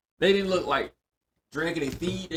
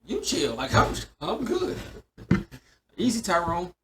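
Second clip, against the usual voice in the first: mock calming down; 6 semitones higher, 3 dB louder than usual. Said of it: "It's like".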